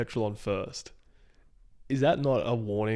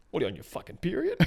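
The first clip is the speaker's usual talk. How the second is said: mock taunting sneer; 8 semitones higher, faster than usual. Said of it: "And he's like".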